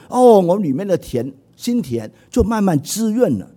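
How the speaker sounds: ordinary delivery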